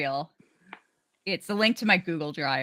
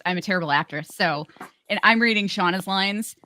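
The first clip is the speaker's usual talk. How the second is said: ordinary talk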